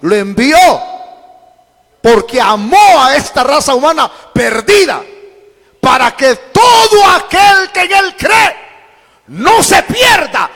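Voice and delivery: building to a high, sustained shout; full-force preaching. Real, not acted. Preacher proclaims God's love for lost humanity, shouted preaching.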